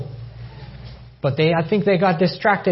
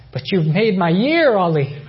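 greatly encouraged; 5 semitones higher, 3 dB louder than usual. Retold or imitated. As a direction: retell a friend's joyful reply, stretching it out. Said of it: "He was greatly encouraged".

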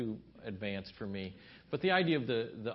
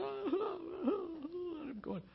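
normal talking voice

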